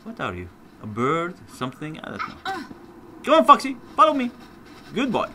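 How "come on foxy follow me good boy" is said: high-pitched coaxing call; raised pet-talk voice. Real, not acted. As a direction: coax an animal to follow. Calling a pet fox.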